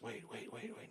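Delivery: hushed, rising nervous pleading; hushed nervous wait, wait, wait; nervous hustler. Retold or imitated. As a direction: stall them, whispering in a panic. Nervous hustler stalling them.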